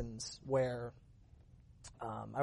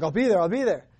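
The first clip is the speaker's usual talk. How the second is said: eager acceptance; 4 semitones higher, 8 dB louder than usual. Retold or imitated. Dad eagerly accepts invitation.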